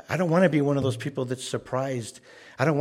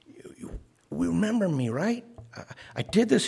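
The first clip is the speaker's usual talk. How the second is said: higher, rising pleas, rising to the end; an anxious man begging to be recognized; pleading claimant; 6 semitones higher than usual. Retold or imitated. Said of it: "He's going".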